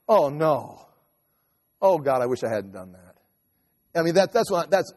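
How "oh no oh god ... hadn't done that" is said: low, hushed lament over his own past; remorseful groan. Retold or imitated. Preacher imitating himself remembering his mistakes, low and remorseful. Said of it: "I was just like".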